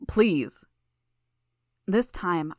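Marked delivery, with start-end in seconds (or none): 0.1-0.6 louder, urgent begging; desperate plea. Acted. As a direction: push the plea harder. Father begs again, harder.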